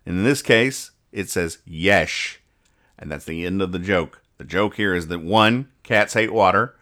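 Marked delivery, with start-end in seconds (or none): 1.6-2.4 "Yesh" with the S turned to SH; deadpan "Yesh", yes with an SH sound; cat's speech impediment. Acted. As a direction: let "Yesh" finish on a long SH. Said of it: "it makes an S and H sound when it's trying to say yes".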